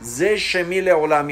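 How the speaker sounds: slow, drawn-out scriptural reading; verse recitation. Shown as a demonstration. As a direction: recite the verse slowly, savouring each word. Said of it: "اینگونه فرمود". (قرائت آهسته و کشیدهٔ آیه)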